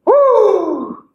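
high, whooping cheer of relief; excited cheer. Real, not acted. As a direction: whoop like you just finished. Cheering as the workout finishes.

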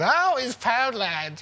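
put-on high, loud, drawn-out drunk holler; slow, whooping drunken cry; drunken announcement. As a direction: announce yourself like a plastered shopper. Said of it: "she announces her arrival ... drunk as a skunk".

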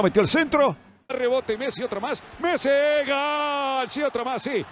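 high racing goal call; breakneck goal commentary. Real, not acted. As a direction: build fast to the goal shout.

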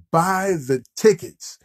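normal talking voice

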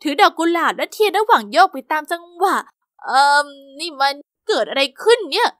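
plain reading voice

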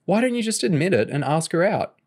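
high-pitched, goading question; teasing challenge. Acted. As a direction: needle a friend to own up. Nicole goads James about Kerry.

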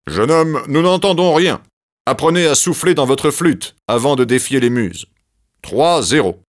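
loud, high reproach; Zeus rebuking the young flutist; scolding Zeus. Acted. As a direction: put him in his place, up and loud.

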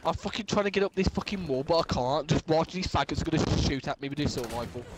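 ordinary talk, nothing marked

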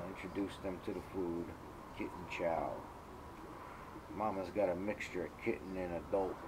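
quiet. Real, not acted. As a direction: talk low so the kittens stay calm. Quiet aside while filming.

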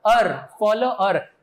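अर stressed, then फॉलोअर said slowly with no v; teaching pronunciation. Shown as a demonstration. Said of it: "इसमें W silent है".

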